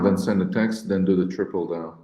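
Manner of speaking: ordinary talk, nothing marked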